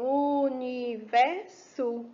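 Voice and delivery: "universo" slowly split into u, ni, ver, so; each syllable of "universo" held apart. Shown as a demonstration. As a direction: say "universo" in four drawn-out beats. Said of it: "completei quatro sílabas".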